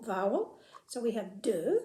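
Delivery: normal talking voice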